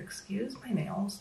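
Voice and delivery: normal talking voice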